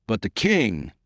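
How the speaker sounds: plain reading voice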